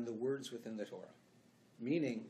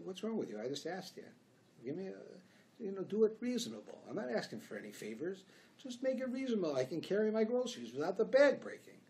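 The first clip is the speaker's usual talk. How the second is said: exasperated retort; 7 semitones higher than usual. Retold or imitated. Retold quarrel with grocery bagger.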